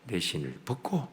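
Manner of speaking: ordinary talk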